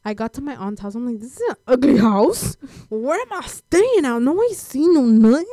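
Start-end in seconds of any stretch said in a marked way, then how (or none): 1.2-5.5 high, hammed-up dismay; comic outraged griping; appalled disbelief. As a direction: complain loudly, pitch climbing as you go. Retells dismay at aunt's house.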